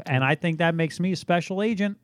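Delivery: ordinary talk, nothing marked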